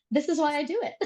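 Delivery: laughing. Real, not acted. Laughing at her own story.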